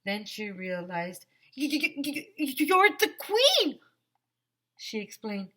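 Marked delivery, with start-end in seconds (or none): 1.6-3.8 high, rising, stuttered gasp; stammering surprise. Acted. Lizzie recognizing the queen, stammering in astonished surprise. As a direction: stutter as the shock sinks in.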